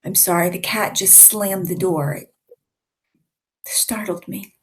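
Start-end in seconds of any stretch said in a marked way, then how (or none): 2.1-4.5 startled whisper. Real, not acted. Cat slammed the door, startled.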